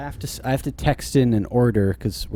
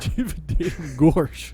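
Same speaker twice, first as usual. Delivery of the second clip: laughing goofy voice; 5 semitones higher, slower than usual. Acted.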